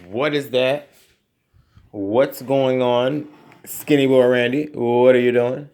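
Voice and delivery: mock viewer. Voicing imagined viewer questions.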